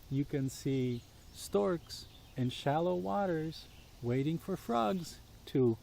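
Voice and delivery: usual speaking voice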